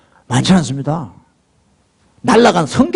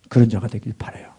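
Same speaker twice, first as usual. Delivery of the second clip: soft breathy whisper, lower and softer; 8 semitones lower, 8 dB quieter, faster than usual. Real, not acted. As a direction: drop to a hushed whisper of hope. Softly wishing for the congregation. (속삭이듯 조용히)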